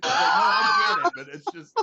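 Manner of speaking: laughing so hard it turns to crying; breaking up with laughter. Real, not acted. Cracking up retelling.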